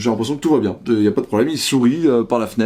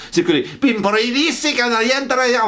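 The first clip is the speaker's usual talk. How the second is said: mocking grandiose name; 11 semitones higher than usual. Mocking fancy foreign names.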